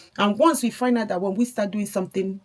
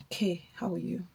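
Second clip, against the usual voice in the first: soft, whispered mock-sweet greeting; whispered, sugary boyfriend imitation; imitating his sweet tone; 8 dB quieter than usual. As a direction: imitate a boyfriend cooing innocently. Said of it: "Like, with this tone".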